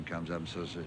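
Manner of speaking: ordinary delivery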